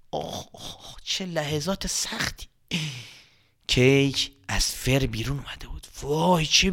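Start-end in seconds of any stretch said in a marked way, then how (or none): 0.1-2.5 very soft, breathy aside; hushed groan. A narrator's reading. Narrator sighing at the hard moment, very soft. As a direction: sigh it under your breath.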